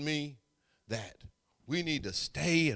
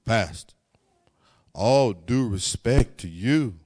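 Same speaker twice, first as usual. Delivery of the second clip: lower, loud respectful address; Antoine's way of talking; 3 semitones lower, 9 dB louder, slower than usual. Retold or imitated. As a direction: call him pastor with emphasis. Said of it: "that's how he talked".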